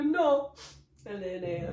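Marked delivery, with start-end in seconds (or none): 0.0-0.5 1 loud mock no. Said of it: "I was pure".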